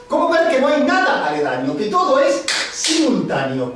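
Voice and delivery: emphatic spoken-word poetry with swinging pitch; poem recitation. Acted. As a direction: declaim the rhymes, landing on each wordplay. Recites own wordplay poem.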